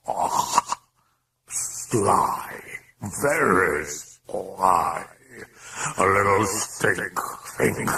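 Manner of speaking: slow, drawn-out demon voice; sly demon. A narrator's reading. Demon sees through the priest's offer, sly relish. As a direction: relish each word slowly.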